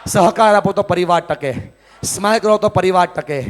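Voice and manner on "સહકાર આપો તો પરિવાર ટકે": ordinary talk, nothing marked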